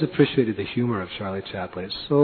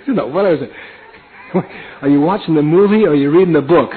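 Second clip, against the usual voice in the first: laughing lady; 6 semitones higher, 10 dB louder than usual. Retold or imitated. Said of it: "She just went".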